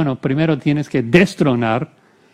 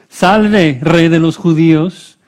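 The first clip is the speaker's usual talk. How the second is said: loud acclamation; 3 semitones higher, 7 dB louder than usual. A narrator's reading. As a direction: proclaim it like a raised salute. Soldiers hailing Jesus in scripture reading.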